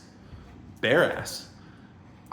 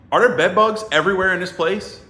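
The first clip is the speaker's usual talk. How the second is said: raised, loud incredulous question; alarmed disbelief; 6 semitones higher, 6 dB louder than usual. Retold or imitated. Visitor questioning lady about bugs everywhere, alarmed. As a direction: replay a freaked-out demand.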